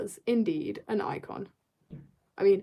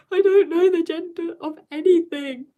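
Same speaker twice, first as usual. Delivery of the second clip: mock despair; 6 semitones higher, 5 dB louder than usual. Comic despair about learning French.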